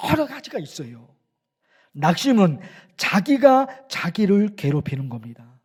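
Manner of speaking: ordinary talk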